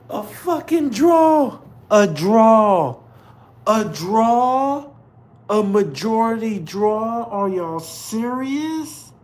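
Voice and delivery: 3 yell it high at the screen, close to tears of anger; 1 outraged disbelief. Real, not acted. 4 to viewers about the judges' draw, loud high outraged disbelief.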